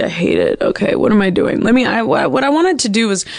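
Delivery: ordinary delivery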